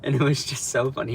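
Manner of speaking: ordinary talk, nothing marked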